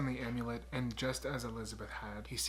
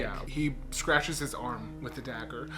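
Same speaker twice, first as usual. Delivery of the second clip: ordinary talk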